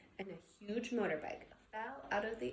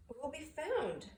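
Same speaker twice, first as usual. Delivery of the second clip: usual speaking voice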